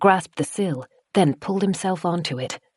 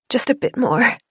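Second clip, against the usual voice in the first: voice cracking; 7 semitones higher than usual. Acted. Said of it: "Her voice cracked".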